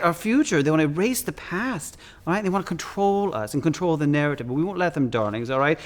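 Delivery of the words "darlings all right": ordinary delivery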